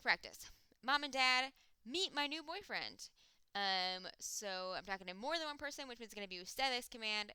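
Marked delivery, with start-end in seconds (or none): 0.8-3.1 higher and louder, eager introduction; excited announcement. Pretend. Girl introducing her new boyfriend to Mom and Dad, excited. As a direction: proudly introduce him to the parents. Practice sentence acted as quote.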